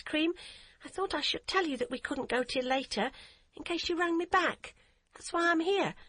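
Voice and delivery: put-on high voice of a careful, earnest eight-year-old; a small girl explaining herself properly; earnest little girl. A narrator's reading. Child voice of Hadia.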